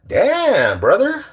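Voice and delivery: high, stretched "damn"; drawn-out exclamation. Real, not acted. Streamer reacting to draft with Cousin, high exclamation. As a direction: hold the word long and high.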